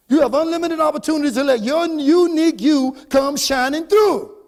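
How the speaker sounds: loud, fervent encouragement; motivational preaching. Real, not acted. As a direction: drive it home to the crowd. Exhorts the audience.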